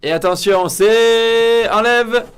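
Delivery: high, loud reveal call; excited announcer. Acted. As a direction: shout the reveal like a game show host. Annonce la révélation.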